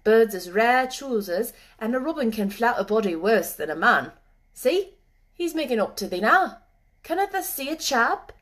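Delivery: a hint of rustic boy; lightly voiced moor boy. A narrator's reading. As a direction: lightly play a moor lad who knows his birds.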